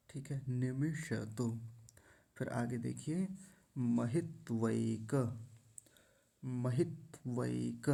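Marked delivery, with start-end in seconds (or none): 0.5-1.5 'निमिष तो' as ni-mi-sha-to, measured; every syllable of 'निमिष तो' whole; full ष. Shown as a demonstration. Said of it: "सही उच्चारण क्या होगा". 3.8-7.9 'महित्वैक' slowly as ma-hit-vai-ka; careful syllables in 'महित्वैक'; full ऐ sound. Shown as a demonstration. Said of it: "ऐसा उच्चारण होगा".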